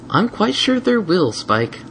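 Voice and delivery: slow, sweet and teasing; gentle mare voice. Acted. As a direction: coax him along. Twilight voiced.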